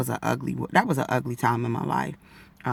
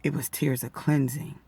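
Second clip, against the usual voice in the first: ordinary talk, nothing marked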